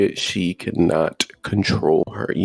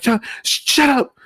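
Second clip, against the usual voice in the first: higher, shrill-free mock snap at him; high mimicked girlfriend telling him to shut up; mock girlfriend yell; 14 semitones higher, slower than usual. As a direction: imitate her snapping shut up in a high pitch. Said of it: "And she's like".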